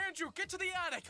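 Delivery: high-pitched heroic order; acted urgency. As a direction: send a friend to safety under mock attack. Acted order during dream attack.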